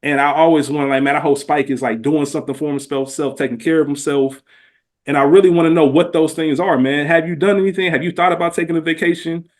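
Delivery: ordinary delivery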